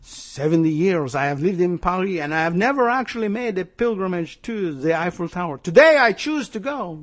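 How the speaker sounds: hammed-up bitter Frenchman's accent; mock French accent, swelling toward the end; terrible French accent. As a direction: badly fake a French accent, grandly declaiming. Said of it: "It's a terrible French accent".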